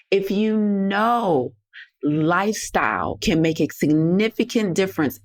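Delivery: deliberate slow emphasis; slowed right down. Shown as a demonstration. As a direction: stretch each word out. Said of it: "I'm gonna say that again real slow".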